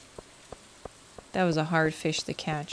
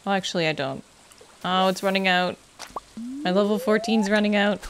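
ordinary delivery

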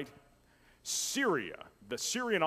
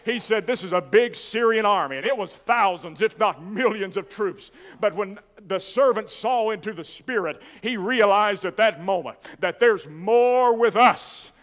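raised, driving pulpit delivery; full-force preaching; 7 semitones higher, 10 dB louder than usual. Real, not acted. Preacher telling the church that prayer is a battle, full-force preaching. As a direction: preach it hard to the congregation.

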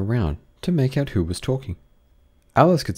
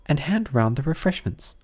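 Alice's thoughts; 7 semitones higher than usual. A narrator's reading. Alice thinks aloud.

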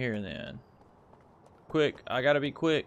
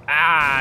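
sudden loud "ah"; loud yelp; 4 semitones higher, 14 dB louder than usual. Real, not acted. Gamer after hitting a guy while driving, loud yelp. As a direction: blurt out a loud cry at the crash.

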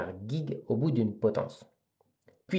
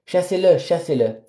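loud raised cry fading after the first; shouting; 6 semitones higher, 4 dB louder than usual. Acted. Village women driving the brute away, raised shout. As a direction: call out to drive him away.

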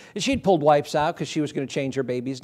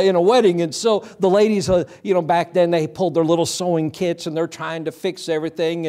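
ordinary talk

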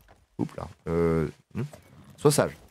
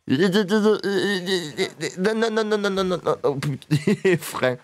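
rapid, much higher panicked nos; 8 semitones higher, much slower than usual. Real, not acted. Player panicking as his horse bolts, high panicked nos. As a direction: yelp no as the horse runs off.

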